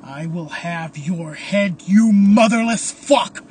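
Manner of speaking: building to a loud shout; furious threat. A narrator's reading. Caleb threatens the Phantom, building to a furious shout. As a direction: start measured, explode on the insult.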